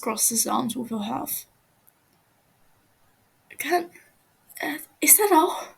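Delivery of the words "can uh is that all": higher, stammering and pleading; frightened prisoner begging, voice climbing; scared captive pleading. Acted. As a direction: stumble over the words, terrified of him. Captive asks timidly.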